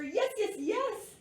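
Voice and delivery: high, emphatic repeated agreement; excited yeses. A narrator's reading. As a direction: punch each one with rising eagerness. Excited translation of oui.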